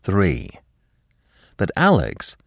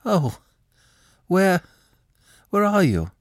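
flustered; 12 semitones higher than usual. Acted. Alex flustered on the phone.

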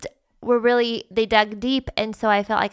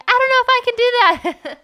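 high squeaky voice; 6 dB louder than usual. Retold or imitated. Wife acts out her panicked reply.

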